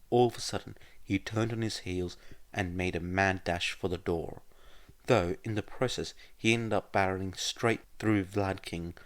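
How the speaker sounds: plain reading voice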